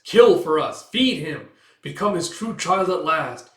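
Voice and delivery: loud, urgent creature demand; forceful, insistent exhortation; commanding. Acted. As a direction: order it like a cult emissary. Rat demands a sacrifice.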